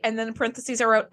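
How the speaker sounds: usual speaking voice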